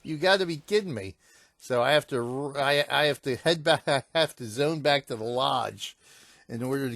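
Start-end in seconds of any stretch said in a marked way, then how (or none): 0.0-1.2 [name] yelped much higher, then exasperated; imitating his own frustrated outburst; exasperated disbelief. Gamer retelling the bear killing him, exasperated. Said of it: "So I'm like".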